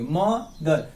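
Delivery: म and दर said apart; broken into syllables. Shown as a demonstration. Teacher shows where the word breaks, syllable by syllable. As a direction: break मदर into म, then दर. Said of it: "यहां से तोड़ के बोलेंगे".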